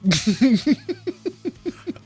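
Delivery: high laugh. Real, not acted. Laughing at own forgetfulness.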